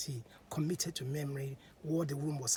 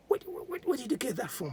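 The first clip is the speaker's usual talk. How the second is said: much higher, breathless stammer; amazed woman seeing coral beads; dazzled surprise; 9 semitones higher than usual. A narrator's reading. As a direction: gasp at the beads in wonder. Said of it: "Her eyes were blinking".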